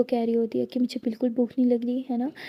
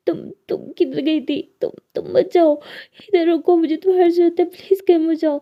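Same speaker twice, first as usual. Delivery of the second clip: tearful pleading; 5 semitones higher, 5 dB louder than usual. A narrator's reading. Lily clings, begging friend stay.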